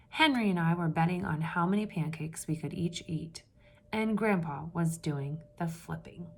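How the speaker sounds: steady read-aloud storytelling voice; story narration. A narrator's reading. Teacher reading a picture book to the class, storytelling voice.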